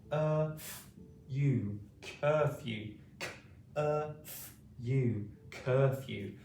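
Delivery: Curfew split into Uh and You, then joined, with long pauses; sounding out curfew. Shown as a demonstration. Teacher drilling Curfew sound by sound. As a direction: break Curfew into its sounds, then blend it back. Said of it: "is curfew".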